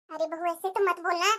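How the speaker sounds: hushed. Acted. Mother hushes daughter-in-law again.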